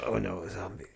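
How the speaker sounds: low, quiet mumbling brooding bad boy; mock an underplayed actor, barely audible; Norman Reedus. Co-host mocks Norman Reedus's acting in The Walking Dead, low brooding mumble. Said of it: "it's the brooding bad boy".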